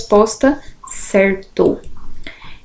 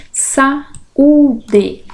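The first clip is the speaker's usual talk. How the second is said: "Saúde" slowly split as sa, ú, de; syllable by syllable; 3 semitones higher than usual. Shown as a demonstration. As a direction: separate the ú in "Saúde" as its own syllable. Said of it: "Separação de sílabas".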